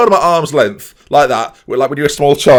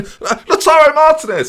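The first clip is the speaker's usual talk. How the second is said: much higher caricature shout of a name; mock Maguire call; 4 dB louder than usual. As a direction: call out like a needy player. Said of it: "Harry Maguire's piping up, going".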